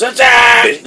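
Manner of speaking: high, full-force battle shout; shouted charge. Acted. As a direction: yell the order to charge.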